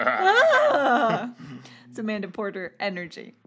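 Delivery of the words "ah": high neighing laugh, louder, like a whinny; bursting neigh of a laugh; horse neigh laugh on request. Shown as a demonstration. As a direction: redo the whinny laugh for your friend. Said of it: "Can you do that again?".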